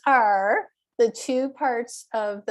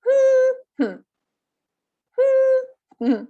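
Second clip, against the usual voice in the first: HOO-hmm, first beat punched high; exaggerated stress hum; 6 dB louder than usual. Shown as a demonstration. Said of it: "that's a little exaggerated".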